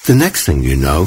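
a hint of a stern mother; scolding. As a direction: tell him off, disbelieving. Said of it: "his mother scolded".

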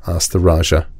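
usual speaking voice

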